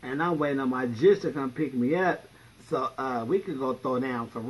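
ordinary talk

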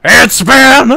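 loud excited call; shouting. Real, not acted. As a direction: shout the name out. Shouting the name.

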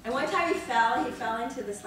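ordinary talk

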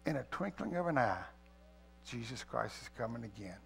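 reverent reading. Acted. Pastor quoting scripture to the church, softly fading. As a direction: let the verse fall away softly. Quotes Bible, softening.

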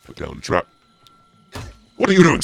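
normal talking voice

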